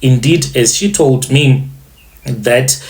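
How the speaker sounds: plain reading voice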